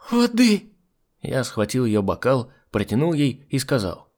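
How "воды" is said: loud, very high cry; distressed demand. A narrator's reading. Stricken hostess on the sofa demanding water, distressed. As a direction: call out urgently for help.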